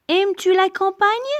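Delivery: high rising tune ending up on "campagne"; question intonation. Shown as a demonstration. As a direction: ask it with the pitch climbing to "campagne". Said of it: "L'inversion du sujet".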